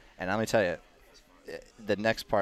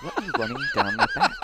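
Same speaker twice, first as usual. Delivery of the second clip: alarmed; 5 dB louder, faster than usual. Retold or imitated. Alarmed at fuel on back.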